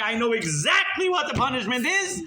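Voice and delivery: high, emphatic mock shout; defiant. Congregant answering warning about terrible punishment, defiant. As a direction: hit it hard, proudly unrepentant.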